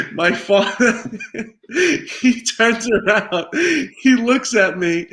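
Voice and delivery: words broken by real laughter; can't stop giggling while telling it; laughing as he recalls his dad; laughing through it. Real, not acted. Laughing while retelling father story.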